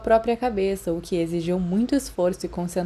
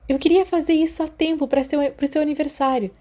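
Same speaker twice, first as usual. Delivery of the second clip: higher, chatty schoolgirl voice; eager girl; 7 semitones higher than usual. Acted. Lily explains the acetate gift to Remus, high and chatty. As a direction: chatter on proudly, a bit higher.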